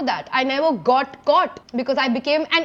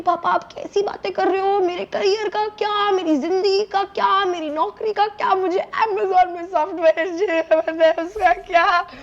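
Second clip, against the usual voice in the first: tearful pleading; 3 semitones higher than usual. Retold or imitated. Daughter pleads with dad about her career, tearful. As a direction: beg through mock tears.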